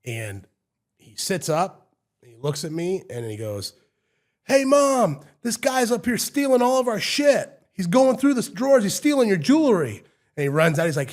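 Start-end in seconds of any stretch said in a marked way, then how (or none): 4.4-10.0 loud, high tattletale yell; high-pitched full-voice shouting; kid hollering for Mom. Retold or imitated. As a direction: holler an alarmed accusation to someone in another room. Retold kid yelling to his mom.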